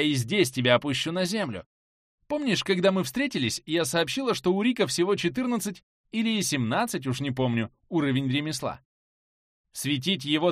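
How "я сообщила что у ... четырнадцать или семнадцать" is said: plain reading voice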